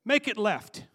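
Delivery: quoting a phrase nobody uses; mock saying. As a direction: toss it off as a silly phrase. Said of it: "I've never heard anybody say".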